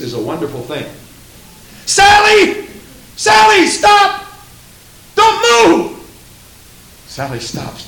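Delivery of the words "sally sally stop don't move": very high, very loud alarmed father's cry; panicked shout. Acted. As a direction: scream a warning to freeze your child. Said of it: "panicking father cries out".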